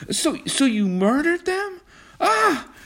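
high, loud, aghast gasp; horrified cry. A narrator's reading. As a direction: cry out in horror. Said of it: "cried John".

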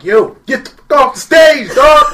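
high, loud heckler yelling to the stage; a crowd heckler shouting a comic off stage. Retold or imitated. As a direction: bellow it from the back of the room.

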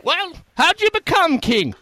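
very high, loud peasant. Retold or imitated. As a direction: question him in a squeaky voice. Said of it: "thank you for that performance".

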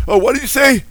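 high, loud put-on cry to God; mock prosperity teacher. Preacher parodies teachers claiming to hear God, mocking. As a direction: call out in showy, hammed-up astonishment.

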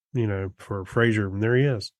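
ordinary delivery

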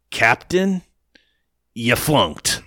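lightly voiced clipped officer line; a hint of a stiff military dismissal; mocking the major. Quoting the major to the co-host with a light officer voice. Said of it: "He says it like that".

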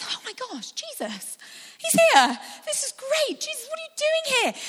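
high, thrilled gushing at Jesus; excited. Acted. Listener greeting Jesus in the courtyard, thrilled and high. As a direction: gasp with delight at seeing him.

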